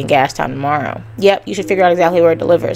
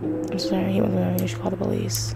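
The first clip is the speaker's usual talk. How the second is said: soft apology; 9 dB quieter than usual. Acted. Breaking bad news to widow.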